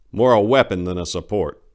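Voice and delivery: ordinary delivery, nothing marked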